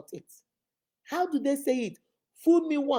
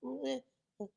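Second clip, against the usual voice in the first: ordinary talk, nothing marked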